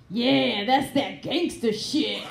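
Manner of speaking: man approving the mess, deadpan; cool approval. Retold or imitated. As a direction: nod along, totally unbothered. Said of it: "he looks down and goes".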